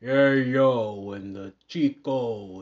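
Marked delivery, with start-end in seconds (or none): 0.0-1.1 put-on loud, drawn-out Scarface accent; [name]'s Tony Montana accent. Mimicking a wrestler stuck in character, loud Scarface accent. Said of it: "Tony Montana, you know, Scarface, um, accent". 1.7-2.6 lightly mimicked Scarface accent, louder; [name]'s Tony Montana accent. Mimicking a wrestler stuck in character, Scarface accent.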